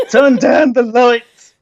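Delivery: drawn-out, high, loud holler; exaggerated loud complaint; mock yell. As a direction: shout it slowly in comic exasperation. Mock outburst at the bloom.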